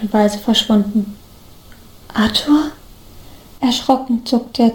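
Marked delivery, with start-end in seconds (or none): 2.1-2.7 whispered, trembling question; hushed, quavering whisper; rough, trembling voice. A narrator's reading. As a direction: whisper the name in shaky disbelief. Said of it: "die raue und bebende Stimme".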